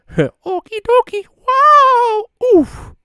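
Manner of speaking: very high cartoon plumber exclamations; Mario. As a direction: squeak them out with big game-character energy. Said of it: "I can do a good Mario impression".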